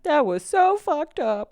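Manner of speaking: high, loud, drawn-out wail; hammed-up tearful protest; mock full-force crying. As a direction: wail it slowly like a crybaby. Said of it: "full force crying".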